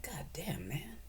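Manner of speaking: whispered, hushed oath; hushed disbelief. Retold or imitated. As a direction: breathe it out in disbelief. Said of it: "And I'm like".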